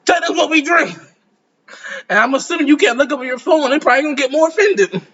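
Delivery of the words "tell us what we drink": higher mimicked heckler voice; mock high-pitched demand; German man. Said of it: "German man sitting up to you like".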